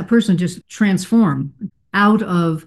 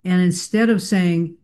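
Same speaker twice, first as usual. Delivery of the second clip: ordinary delivery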